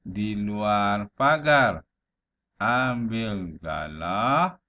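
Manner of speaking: "di luar pagar", stop, then a slow "Ambil galah"; slow recital, halting after "pagar" and "galah"; pauses between phrases. Shown as a demonstration. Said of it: "berhenti sekejap".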